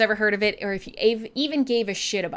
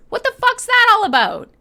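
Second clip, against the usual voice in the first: much higher and louder, indignant; outraged disbelief; 5 semitones higher, 6 dB louder than usual. Real, not acted. Host scandalised by Americans renaming Nanaimo bars, outraged. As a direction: demand an answer, scandalised.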